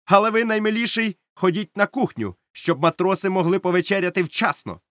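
high, insistent command; captain's order. A narrator's reading. Captain orders the cook to the galley, commanding. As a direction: send him off with authority.

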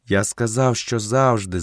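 usual speaking voice

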